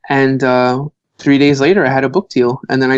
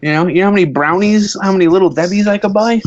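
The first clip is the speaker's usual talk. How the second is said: higher-pitched imitation of an excited little kid; thrilled Irish kid; 6 semitones higher than usual. Irish kid dreaming of twenty dollars' treats, imitated thrilled child. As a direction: gush like a little boy with riches. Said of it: "Irish kid would just be like".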